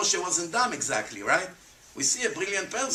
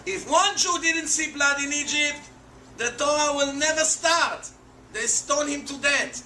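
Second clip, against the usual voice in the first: emphatic high exclamation; 9 semitones higher than usual. Real, not acted. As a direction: preach it up high with alarm. Preacher stresses a dire consequence.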